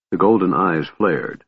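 ordinary delivery

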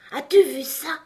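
high, loud outraged question; choked with anger. A narrator's reading. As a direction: demand it, choking on fury. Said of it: "d'une voix que la colère étouffait".

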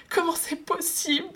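high, wailing mock whimper. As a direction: whine it near tears. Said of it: "pleurnicha-t-il".

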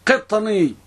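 "قطني" with a long final ee; إثبات الياء. Shown as a demonstration. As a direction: draw out the final ya of "قطني" slowly. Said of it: "مع ياء". (نطق "قطني" بمد الياء في آخرها)

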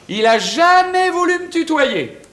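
high, slow, stretched complaint; emphatic outrage. Acted. Husband complains of cousin's formality, high and emphatic. Protests about his estranged cousin.

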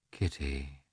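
very soft, low murmur of her name; hushed, loving husband; tender appeal. A narrator's reading. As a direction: breathe the name gently, to soothe her. Husband tenderly takes her face.

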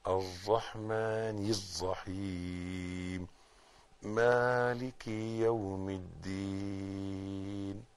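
soft, slow Quran recitation; quiet recitation. Shown as a demonstration. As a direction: recite gently, drawing out the vowels. Recites Fatiha slowly.

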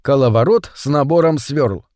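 plain reading voice

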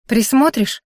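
ordinary delivery, nothing marked

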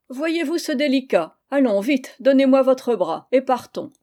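aunt mocking her friend and demanding his arm, loud and high; teasing. A narrator's reading. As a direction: hurry him along with a tease.

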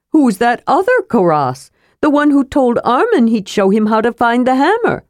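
high, questioning kender voice; Tass. A narrator's reading. Kender asks about the other Karas, high and curious. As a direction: puzzle aloud, pitched well up.

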